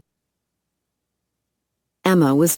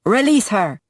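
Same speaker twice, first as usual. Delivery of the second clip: loud commanding yell; 6 semitones higher, 3 dB louder than usual. A narrator's reading. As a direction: shout the order across the roof. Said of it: "Robbie yelled".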